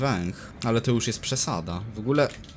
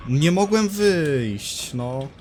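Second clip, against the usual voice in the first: frustrated whine; 6 semitones higher, 6 dB louder, much slower than usual. Real, not acted. Trapped, frustrated.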